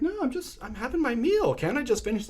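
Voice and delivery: imitating a falsely sweet backpacker; mock innocent stranger. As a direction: play a harmless guest asking to finish his meal. Backpacker feigning innocence at dinner.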